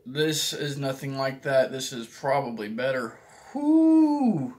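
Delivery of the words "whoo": high, loud hooting whoo; high whoo from spicy heat. Real, not acted. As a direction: holler at the heat.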